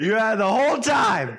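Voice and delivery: high, loud mock scolding; fake-furious yell at a friend. Mock scolding over the cough.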